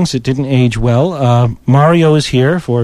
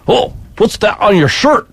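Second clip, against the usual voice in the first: put-on drunk Scottish accent, pitched higher; 7 semitones higher than usual. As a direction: play a tipsy Scottish rocker, voice up. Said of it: "he had a thick Scottish accent".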